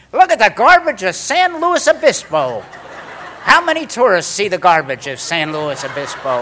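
loud, high tour-guide gushing; hammed-up, high-pitched marvelling; mock sightseeing enthusiasm. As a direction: exclaim it like a thrilled tourist. Mock tour of back alleys.

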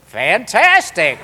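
loud, drawn-out cheer; thrilled. Retold or imitated. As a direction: stretch it out in high-pitched delight. Couple thrilled to get a table.

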